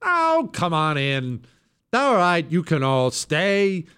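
high, drawn-out sing-song invitation; hammed-up easygoing host; mock welcoming. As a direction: wave everyone in with exaggerated generosity. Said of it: "But when it comes to America".

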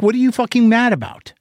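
high, sharp exasperated outburst; angry demand. Retold or imitated. Son yelling down the phone at his raging father, angry. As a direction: snap the question at him in frustration.

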